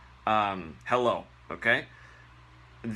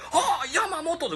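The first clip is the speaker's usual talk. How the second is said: mock theatrical wail; 3 dB louder than usual. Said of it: "We don't do".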